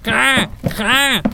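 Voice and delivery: high shrieking caw; terrified raven cry. Acted. Frightened raven screaming at the window, high terrified caw. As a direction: caw in sudden terror. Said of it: "schreit voll Entsetzen".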